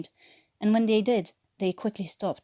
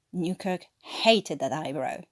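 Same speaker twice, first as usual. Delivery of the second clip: plain reading voice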